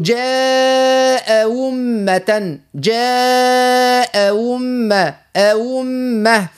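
"جاء أمة" drawn out very slowly with the hamza of "أمة" softened; slow recitation demo of "جاء أمة"; second hamza eased. Shown as a demonstration. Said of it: "نقول". ("جاء أمة" ببطء شديد مع تسهيل همزة "أمة")

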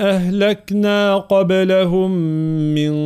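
slow, drawn-out tajweed chant; Quran recitation. Acted. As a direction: recite each word slowly and melodically. (تلاوة مجودة بطيئة)

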